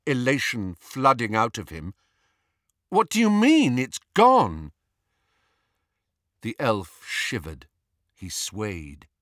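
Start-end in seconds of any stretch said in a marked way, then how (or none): 2.9-4.7 high, loud, falling on the last word; alarmed demand. A narrator's reading. Harry pressing the elf about the missing locket, alarmed. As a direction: demand an explanation in disbelief.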